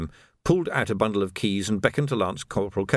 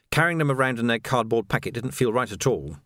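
ordinary delivery

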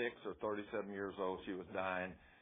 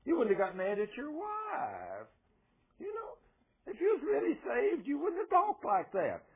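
higher, slow mocking devil voice; devil taunting; 10 semitones higher, 4 dB louder, slower than usual. Devil taunts the believer, higher mocking voice. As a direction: sneer like the accuser. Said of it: "the devil comes up to you and says".

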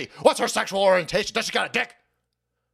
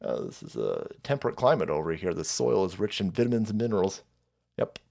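low confident farmer; 8 semitones lower, 5 dB quieter than usual. Retold or imitated. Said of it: "were like".

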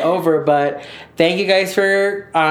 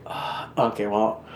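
soft surprised moan; 6 semitones lower, 7 dB quieter, slower than usual. Retold or imitated. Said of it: "because I'm just like".

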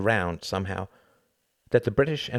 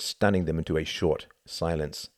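ordinary delivery, nothing marked

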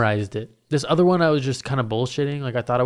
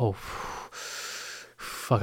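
blown-out whispered curse; acted nervous puffing; panicked exhale; 2 semitones lower, 11 dB quieter than usual. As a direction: let a long shaky-nerved breath carry the curse. Retelling panic before going on stage.

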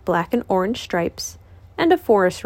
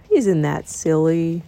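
low, slow gloomy donkey; lowered Eeyore voice; 4 semitones lower, slower than usual. Acted. Eeyore's line.

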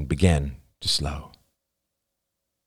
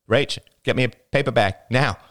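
higher-pitched, pressing command; urgent; 5 semitones higher, 3 dB louder than usual. A narrator's reading. Gasping man to his girlfriend, needing a paper bag, urgent. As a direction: order it like an emergency. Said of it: "The urgency in his voice".

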